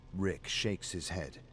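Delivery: ordinary delivery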